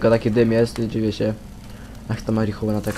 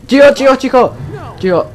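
high, loud, rapid-fire command; urgent shushing shout; 13 semitones higher, 9 dB louder than usual. Real, not acted. Player hushing a screaming woman in the game, urgent high shout. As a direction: shout her down in a high panicked voice.

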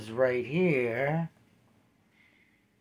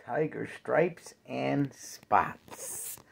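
ordinary delivery

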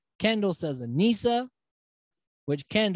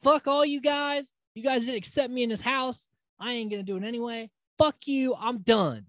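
angry quitting rant; 6 semitones higher than usual. Retold or imitated. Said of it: "She's like".

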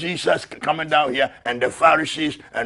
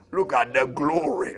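normal talking voice

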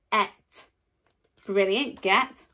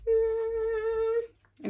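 imitated bus engine; 10 semitones higher than usual. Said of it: "So, here's my bus".

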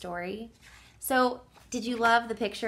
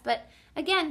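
plain reading voice

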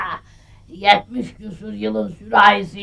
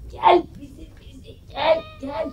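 sweet coaxing; 6 semitones higher, 4 dB quieter than usual. Acted.

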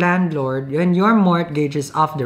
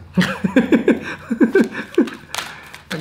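long giggling laugh; high, breathy run of chuckles; 8 semitones higher than usual. Real, not acted. Tarot reader amused by her own remark, laughing.

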